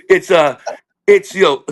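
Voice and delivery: usual speaking voice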